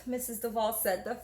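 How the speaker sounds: usual speaking voice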